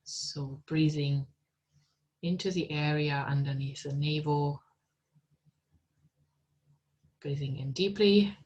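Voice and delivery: calm, unhurried meditation guide voice; guided meditation. Acted. Teacher guiding students into meditation, calm and unhurried. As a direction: lead them gently inward, with long pauses.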